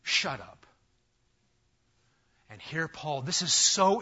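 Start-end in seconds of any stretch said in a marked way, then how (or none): 0.0-0.7 stern rebuke. Shown as a demonstration. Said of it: "not allowed to say "shut up".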